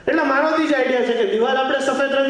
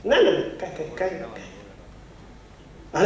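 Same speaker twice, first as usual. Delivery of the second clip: a sly litterer's innocent act, trailing off to a faint slow whisper; sneaky innocent denial; 6 semitones lower, 6 dB quieter, much slower than usual. Acted. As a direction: fake innocence, fading to nothing.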